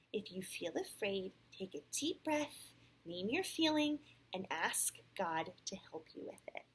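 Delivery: clear, animated read-aloud; storybook voice with lively rises and falls; picture-book narration. A narrator's reading. Parent reads Sam's story to a child, animated storytelling.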